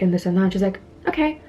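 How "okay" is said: meek compliance. Retold or imitated. Retold abrupt meek switch after screaming.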